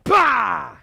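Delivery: loud "Bah!" falling in pitch; kia yell. Shown as a demonstration. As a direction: shout "Bah!" from the gut. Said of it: "vary up your kia".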